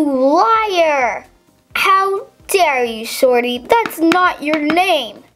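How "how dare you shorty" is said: ordinary talk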